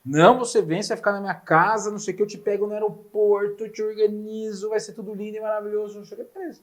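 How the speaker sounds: lightly voiced pushy friend promising everything; a hint of Diego's sales pitch; mock eager promise. Retelling Diego's eager phone promise.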